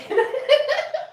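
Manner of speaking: sudden high-pitched laugh; high burst of laughter. Real, not acted. Laughing at her own joke, a high burst.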